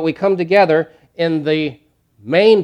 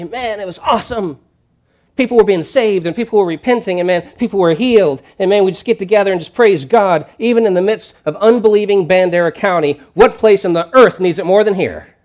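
excited report; 7 semitones higher, 8 dB louder than usual. Pretend. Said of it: "debrief and say".

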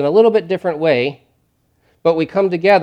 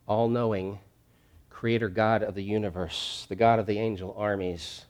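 hushed reverence; 3 semitones lower, 5 dB quieter, slower than usual. Real, not acted. Reverent praise of God.

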